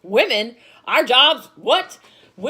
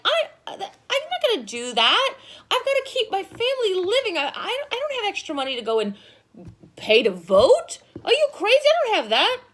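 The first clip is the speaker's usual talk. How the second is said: exasperated refusal; 8 semitones higher than usual. Retold or imitated. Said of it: "most of the people were like".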